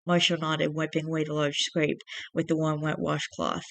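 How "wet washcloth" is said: ordinary delivery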